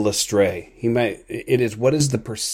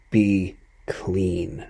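whispered. Retold or imitated. Jesus speaks to the leper in a whisper. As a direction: breathe the words Be clean gently, no voice.

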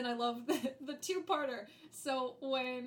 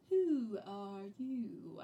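Caterpillar line; much slower than usual. Retold or imitated. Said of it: "say it in a certain way".

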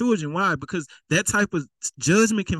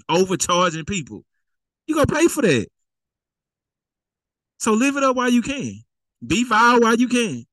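raised, higher preaching cry; fiery preaching; 4 semitones higher than usual. Acted. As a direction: call out the sinners from the pulpit. Preacher warning of judgment.

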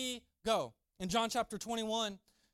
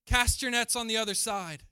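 loud call from the shore; raised, carrying voice; 2 semitones higher, 9 dB louder than usual. Acted. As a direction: call across the water to a boat. Jesus calls from the shore.

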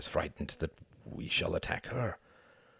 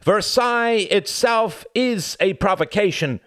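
much higher and louder, slow outcry; cried out; 9 dB louder than usual. Acted. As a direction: cry it out in indignation. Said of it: "cried Cuthbert".

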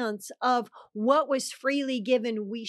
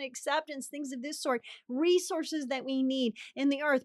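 ordinary delivery

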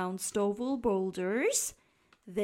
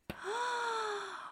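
sharp high gasp; 10 semitones higher, 8 dB quieter than usual. A narrator's reading. Narrator gasps as they tumble. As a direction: gasp in alarm. Squirrels going over edge.

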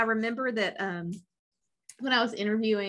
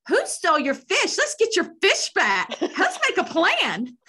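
fired-up; 10 semitones higher, 5 dB louder than usual. Retold or imitated. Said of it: "And then I went to".

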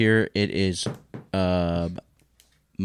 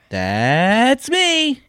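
silly high voice; 10 dB louder than usual. Acted. Dad answering in silly voice.

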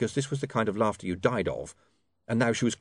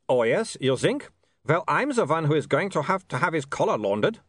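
put-on high, accented vampire, mildly irritated; snapping; 6 semitones higher than usual. A narrator's reading. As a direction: retort crossly as a vampire. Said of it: "snapped Otto".

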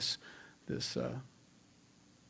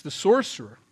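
ordinary delivery

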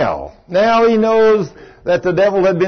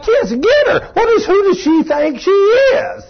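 much higher, shrill little demon taunting; a tiny pestering devil, whiny and slow; high cartoon devil needling him about his wife; squeaky devil voice. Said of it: "You know how these devils are".

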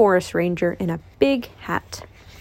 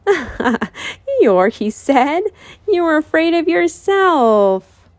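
high, slow amused teasing; 7 semitones higher, 3 dB louder, much slower than usual. Acted. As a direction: tease him gently, pitched up. Christopher Robin laughs at Eeyore.

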